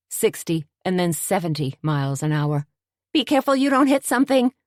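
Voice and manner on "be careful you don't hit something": warning. Acted. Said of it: "Tony warned".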